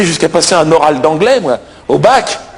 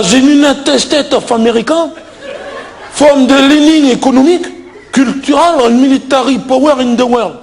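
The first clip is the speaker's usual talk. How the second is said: put-on higher-pitched, laboured reading in French-accented English; thick French-accented English; 5 semitones higher than usual. Candidate reading the exam text aloud, heavy French accent.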